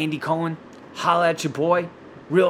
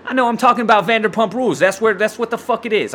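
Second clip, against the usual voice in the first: suddenly louder, higher burst; excited outburst; 6 semitones higher, 5 dB louder than usual. Real, not acted. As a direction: blurt it out all fired up. Excited outburst about the show.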